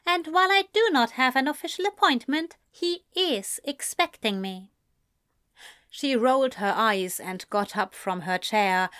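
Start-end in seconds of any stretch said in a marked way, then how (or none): 0.0-4.7 a hint of a higher, measured gentleman; poised young man insisting politely; Ezra, lightly higher, cool and composed; lightly voiced Ezra. Ezra cuts her off.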